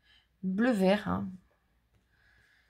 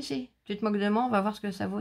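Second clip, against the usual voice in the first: usual speaking voice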